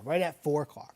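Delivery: normal talking voice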